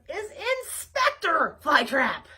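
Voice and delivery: loud yell. Acted. Flytrap yells back at his chasers, loud. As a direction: shout it back at them. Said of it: "I yelled back".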